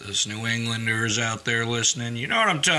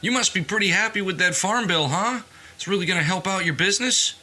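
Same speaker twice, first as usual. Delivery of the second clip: higher, faster mock tipsy guy; imitating a tipsy stranger; 8 semitones higher than usual. Tipsy stranger in the package store asking about the farm bill, higher, quicker. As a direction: lightly play the chatty drunk in the store. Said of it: "And he's like".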